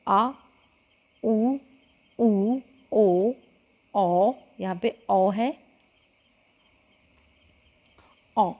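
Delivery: आ उ ऊ ओ औ sounded one by one and held; vowel demonstration. Shown as a demonstration. Teacher demonstrates back vowels to students, slowly sounded. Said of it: "उदाहरण के तौर पर".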